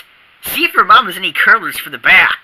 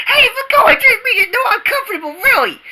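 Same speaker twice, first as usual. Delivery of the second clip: squeezed protest; faster than usual. Acted. Being squeezed by tiger.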